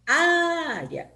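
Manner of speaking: "Área" with a long, high, loud first A; stressed vowel demo. Shown as a demonstration. Teacher giving a diphthong example, exaggerated stress on "Área". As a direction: stretch the Á of "Área", drop off on "ea". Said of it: "eu pronuncio uma mais forte que a outra".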